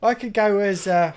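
ordinary delivery

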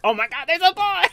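high, loud mock freak-out; over-the-top high-pitched alarm; hammed-up panic. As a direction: exaggerate a dad's inner shriek. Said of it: "Well, he doesn't do that".

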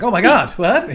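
mock shock. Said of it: "It'll be like".

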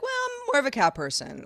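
a hint of a put-on objector; other people. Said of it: "other people are like".

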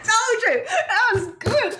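high, drawn-out words through laughter; giggly, high-pitched triumph; laughing. Real, not acted. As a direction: crow it while cracking up. Laughing after own joke lands.

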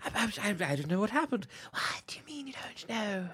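hushed, unsettled villain whisper; sinister stage whisper; conspiratorial whisper. Acted. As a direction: whisper it very softly, almost no voice. Acted hushed Quirrell and Voldemort exchange.

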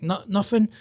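a hint of caught-out fluster. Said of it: "Just completely flustered".

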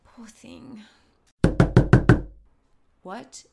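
breathy hushed whisper. Acted. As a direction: keep it barely audible, sneaking. Sneaking in the dark.

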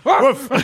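loud, high imitated woof; dog bark. Dog answering the baffled cops, loud high woof. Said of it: "And he's like".

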